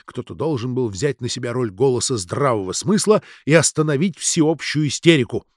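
usual speaking voice

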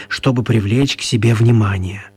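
ordinary talk, nothing marked

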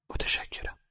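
barely able to whisper thanks; a dying man's faint whispered thanks; weak whisper. A narrator's reading. Starving prisoner thanks the mother, whispering faintly.

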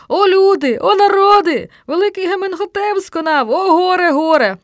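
high, loud theatrical wailing; desperate shriek. A narrator's reading. Boy lamenting the dead pharaoh to the people, shrieking. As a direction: shriek it out like a mourner. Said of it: "Так очайдушно заверещав Стьопа".

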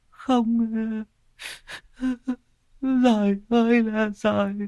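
put-on a grieving mother lulling her dead child; sobbing lullaby. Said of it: "mà mếu máo".

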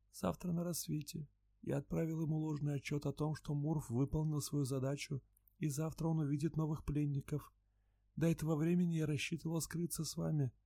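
high, very soft, eerie murmur; a frightened creature confessing quietly; soft alien voice. A narrator's reading. Luun's held voice.

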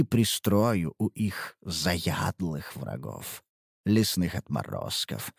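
low, slow menacing slaver; sly dealer voice. Acted. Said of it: "dealer voiced in story".

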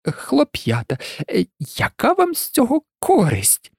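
hesitant plea, rising high at the end; faltering, uneasy appeal; feeble mumbling. A narrator's reading. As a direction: plead weakly with your captors. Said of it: "ледь чутно промимрив він".

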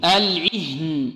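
العهن stretched slowly with kasra on the ain; slow drawn-out recitation. Shown as a demonstration. Teacher to students, slow model recitation of العهن. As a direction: draw out العهن, kasra clear under the ain. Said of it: "انطقوا معي، العهن".

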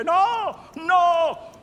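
loud, high-pitched pleading cry; pitiful cry. A narrator's reading. Boys on their knees begging the captain, pitiful cry. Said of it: "gridando pietosamente".

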